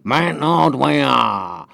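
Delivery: slow, drawn-out cat-style yowl; elongated mimicry of the cat's saying; imitated cat catchphrase. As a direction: stretch the words right out, falling away. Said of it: "practicing how to say".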